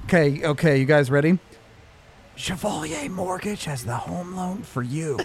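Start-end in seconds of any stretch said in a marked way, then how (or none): 2.4-5.2 soft close whisper into the mic; right in your ear. Pretend. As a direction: lean in and breathe it into their ear. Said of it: "I'll whisper it right into your ear".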